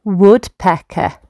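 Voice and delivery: ordinary delivery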